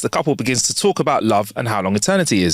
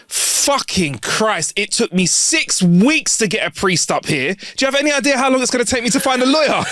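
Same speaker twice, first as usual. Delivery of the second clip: exasperated protest, rising to a high pitch; exasperated Saint Peter; 10 semitones higher than usual. Acted. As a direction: rant like a heavenly gatekeeper at the end of his tether. Exasperated Peter answers the couple.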